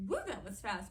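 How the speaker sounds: usual speaking voice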